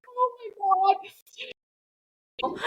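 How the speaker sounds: high, laughing oh my God; half-laughing gasp of disbelief. Real, not acted. Hearing her blazer called a towel, laughing disbelief. Shocked by boss's blazer comment.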